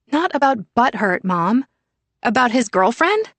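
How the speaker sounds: put-on a curious teen poking at a sore spot; teasing teen daughter. Daughter Sienna teasing her mother.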